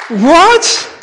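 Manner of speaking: loud, high, stretched questioning yelp; retold astonishment. Stranger hearing Hebrew from a customer, imitated loud astonishment. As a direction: imitate someone stunned by what they heard.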